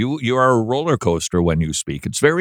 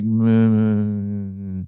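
mock boring reading; 3 semitones lower, much slower than usual. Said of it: "it's gonna be like".

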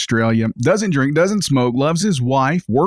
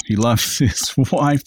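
usual speaking voice

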